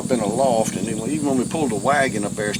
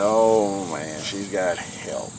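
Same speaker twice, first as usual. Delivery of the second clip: inward groan; 3 semitones lower, 5 dB quieter, much slower than usual. Retold or imitated. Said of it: "I was groaning".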